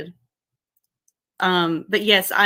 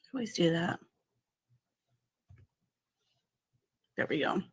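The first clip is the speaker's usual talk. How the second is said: much softer, half to herself while busy; muttering to herself; 10 dB quieter than usual. Real, not acted. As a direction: mumble it aside, away from the mic. Fiddles with link while streaming.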